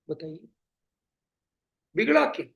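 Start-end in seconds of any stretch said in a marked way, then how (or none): 2.0-2.5 बिगड़ा क्या? louder and higher, बिगड़ा hit hard; emphatic repeat. Shown as a demonstration. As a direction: push बिगड़ा क्या? out as a challenge. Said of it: "इसमें बिगड़ा क्या?".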